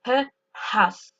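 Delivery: pause, then house on a puff of breath. Shown as a demonstration. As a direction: take a breath and exhale into the H of house. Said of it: "Pour prononcer le H en anglais, il faut prendre sa respiration".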